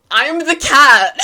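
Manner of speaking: very loud, high comic outburst; real laughing, delighted yell; emphatic exclamation. Real, not acted. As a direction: shout it out, tickled by the likeness. Emphatic comic self-identification with meme.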